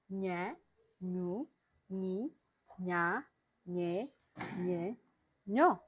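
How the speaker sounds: "Nye, Nu, Ni" with n and y blended, air through the nose; nasal ny sound. Shown as a demonstration. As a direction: say each syllable of "Nye, Nya, Nyo" alone like the Spanish ñ. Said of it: "combination of the Ne and Y".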